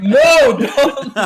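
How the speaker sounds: loud, laughing No don't; laughing shout. Retold or imitated. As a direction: yell it while laughing.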